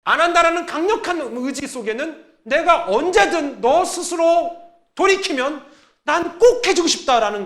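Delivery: high, loud, slow preaching build; full-force preaching. Acted. Pastor preaching hard to the congregation, high and loud. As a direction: drive the point home from the pulpit.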